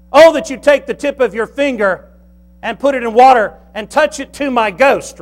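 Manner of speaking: loud, high begging from torment; the rich man in hell pleading loudly. Acted. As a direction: beg for a drop of water.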